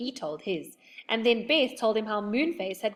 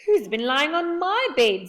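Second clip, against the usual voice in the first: higher and louder mother bear; 7 semitones higher, 7 dB louder than usual. A narrator's reading. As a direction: ask it like a fussy mother bear. Mother Bear's line.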